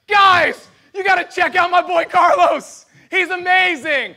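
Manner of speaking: put-on bursting to show off his boy Carlos; excited God. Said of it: "he's so excited, he's like".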